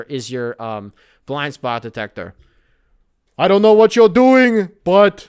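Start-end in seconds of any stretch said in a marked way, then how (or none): 3.4-5.3 much louder, higher rambling politician; Bernie Sanders impression. Bernie Sanders voicing the blind spot detector, loud. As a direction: orate like an old senator. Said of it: "it's Bernie Sanders".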